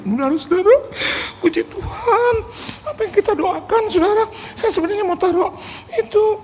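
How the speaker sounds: very high, sobbing mock testimony; imitated weeping preacher. Pastor tells congregation about the gift, imitated weeping. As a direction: squeak the announcement through hammed-up tears. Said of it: "Daripada dia nangis terus".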